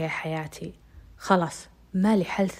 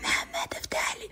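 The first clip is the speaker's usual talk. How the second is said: sobbing whisper; 6 dB quieter than usual. Retold or imitated. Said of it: "قمت أصارخ وأقول".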